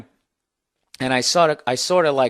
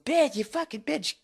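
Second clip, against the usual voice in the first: much higher mock female screech, softer; imitated woman's insults; 12 semitones higher, 4 dB quieter than usual. Woman hits him while cursing, mock high-pitched rant. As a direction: spit the insults like the angry woman. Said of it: "she just kept fucking".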